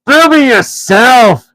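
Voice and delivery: slow, high, loud troll taunt; troll William insulting Tom. A narrator's reading. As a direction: drawl the insult. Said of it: "said Tom".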